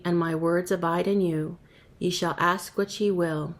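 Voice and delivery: normal talking voice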